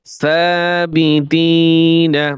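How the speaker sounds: slow drawn-out reading. Shown as a demonstration. Teacher to students, showing how to blend the syllables, slow chanted reading. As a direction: stretch every syllable, very slow and sung out. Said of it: "বানান করে পড়বেন".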